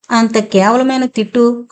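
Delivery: ordinary delivery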